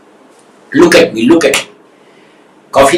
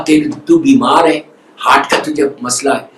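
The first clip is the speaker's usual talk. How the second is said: low and soft, with affection; loving soft speech; 7 semitones lower than usual. Acted. The visitor consoling the heart patient, low and tender. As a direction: comfort a sick man lovingly. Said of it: "بڑی محبت پیار سے".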